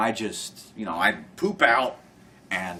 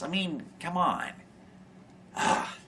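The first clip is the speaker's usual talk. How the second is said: normal talking voice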